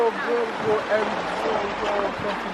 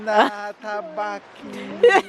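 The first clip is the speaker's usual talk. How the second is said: slow, higher mock Italian accent; put-on Italian accent; 5 semitones higher, much slower than usual. Husband performing for his laughing wife, mock Italian accent. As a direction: draw out the vowels in a playful Italian accent. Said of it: "Say something in an Italian accent".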